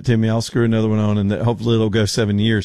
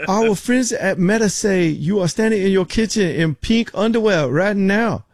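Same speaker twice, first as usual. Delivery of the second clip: high, hammed-up Filipino accent; terrible Filipino accent; 8 semitones higher than usual. Agent telling customer he's being watched, mock Filipino accent. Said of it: "That's a terrible accent".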